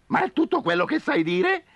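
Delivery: high whiny owl; complaining owl. Acted. Owl complains to Coyote, high whine. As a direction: grumble at Coyote's repetition. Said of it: "si lamentò Gufo".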